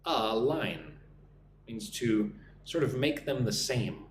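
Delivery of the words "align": align said lower, first sound drawn out; careful word model. Shown as a demonstration. Teacher pointing out a word, align slowly sounded out. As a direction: model align as a long "uh" then "LINE". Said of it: "Look at that word align".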